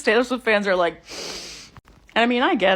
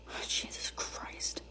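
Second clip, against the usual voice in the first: whispered under her breath, exasperated; muttered aside; 18 dB quieter, slower than usual. Acted. Mom whispering to herself in despair. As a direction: breathe it out, fed up and unheard.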